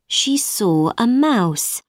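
plain reading voice

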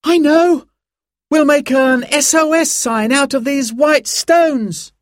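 put-on very high, eager boy's voice; excited cry. A narrator's reading. Simon gets an idea for a signal, crying out excitedly. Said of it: "he cried".

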